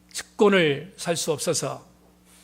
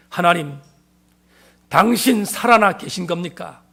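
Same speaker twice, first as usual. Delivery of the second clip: retold loud cry, slow and drawn out; crying out to God; 7 dB louder than usual. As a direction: hurl the question at God, slowly. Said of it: "그렇게 외쳤습니다".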